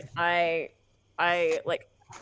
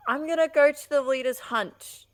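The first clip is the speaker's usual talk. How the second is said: high, loud call to companions; yelling back; 7 semitones higher, 8 dB louder than usual. Acted. Player yells back to companions, loud urgent call. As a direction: shout back over your shoulder. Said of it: "yell back to Fëanor and Theodric".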